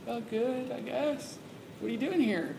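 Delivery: a hint of nervous confusion; nervous reply.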